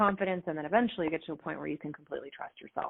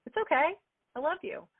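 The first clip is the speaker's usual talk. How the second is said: imitating husband; 9 semitones higher than usual. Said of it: "my husband, he's like".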